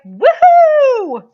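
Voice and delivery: high, loud delighted cheer; excited whoop. Retold or imitated. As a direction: let out a big joyful whoop. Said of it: "there's a part of me that's like".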